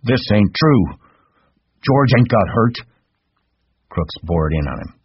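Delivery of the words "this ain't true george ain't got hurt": much higher, louder alarmed denial. A narrator's reading. Lenny denying to Crooks, alarmed and high. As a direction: refuse to believe it, frightened.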